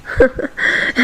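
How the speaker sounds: short, breathy huff of laughter; a quiet dismissive chuckle; scoffing laugh. Acted. Scoffing at his summary.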